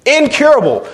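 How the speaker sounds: Incurable cried out high and strong; high emphatic preacher's cry. Real, not acted. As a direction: throw Incurable out high to the crowd. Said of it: "preacher punching the word".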